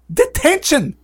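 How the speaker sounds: high, loud outraged cry; acted scolding shout; shouted Professor McGonagall. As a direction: hand down punishment at full indignation. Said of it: "she shouted".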